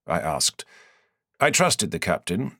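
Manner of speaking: plain reading voice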